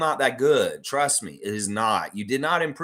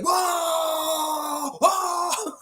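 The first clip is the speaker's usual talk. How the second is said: excited yell. Retold or imitated. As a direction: whoop it out like a hyped coach. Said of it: "he's like".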